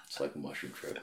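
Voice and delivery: a low, very soft echo of the friend's line; mock echo. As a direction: repeat it back under your breath. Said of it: "You motherfucker".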